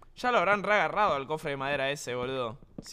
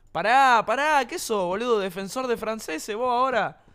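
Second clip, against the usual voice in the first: loud, higher teasing outburst; raised voice; 5 semitones higher, 6 dB louder than usual. Real, not acted. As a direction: blurt it out louder without noticing. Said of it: "subí mi tono de voz sin darme cuenta".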